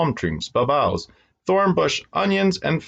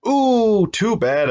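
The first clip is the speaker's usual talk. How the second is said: mock dismay; 13 semitones higher, 4 dB louder, much slower than usual. Neighbors react to paint blot.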